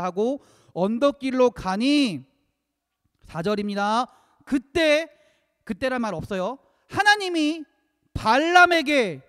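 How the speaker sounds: loud, high scripture reading from the pulpit; preach. Acted. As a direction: declaim the verses loudly, stretching out the climax. (힘주어 외치는 성경 봉독)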